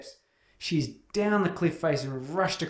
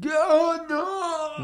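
much higher, louder alarmed cry; mock cry; 14 semitones higher, 4 dB louder than usual. Mocking Shepard pulling his son out, loud high cry. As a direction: yelp it like a panicked hero. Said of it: "He goes".

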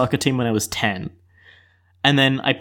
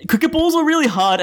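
higher-pitched, defensive protest; defensive insistence; 11 semitones higher than usual. Real, not acted. Podcast guest answering a jab about excuses, defensively insistent.

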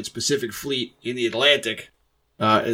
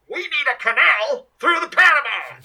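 high yell through a paper cone; Theodore Roosevelt megaphone yell; 14 semitones higher than usual. Retold or imitated. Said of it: "my best Theodore Roosevelt yelling through a cone-typed amplifier".

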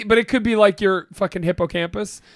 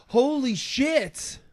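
slow drawn-out gasp of shock; hammed-up gasp at the twist; mock gasp; 4 semitones higher, much slower than usual. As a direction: gasp like a movie twist hit. Gasp at reveal.

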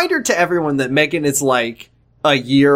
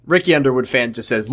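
ordinary delivery

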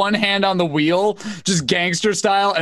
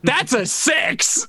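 excited shout; 7 semitones higher, slower than usual. Real, not acted. Excited shout at good roll.

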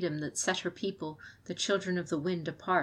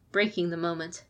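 usual speaking voice

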